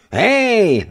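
high, loud cry of alarm; a boy calling out at a stranger; sharp, high, loud hey. Acted. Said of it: "zvolal Jegorka".

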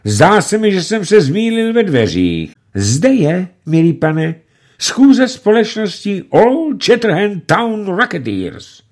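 smooth and officious; a hint of an unctuous bandit. A narrator's reading. Bandit clerk speaks politely. (úlisně zdvořile)

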